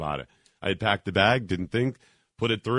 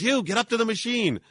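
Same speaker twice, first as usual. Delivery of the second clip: agent yelling; 12 semitones higher than usual. Retold or imitated.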